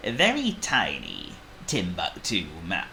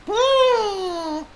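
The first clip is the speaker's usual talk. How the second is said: very high, loud tiny-mouse "woo"; high wail; 7 dB louder than usual. A narrator's reading. Squeak wails on meeting elephant.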